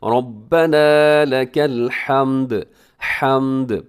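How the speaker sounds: slow chant with a drawn-out final हम्द; tajweed recitation demo; lengthened ending. Shown as a demonstration. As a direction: stretch out the end, chanting slowly. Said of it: "आखिर में थोड़ा लंबा कीजिएगा".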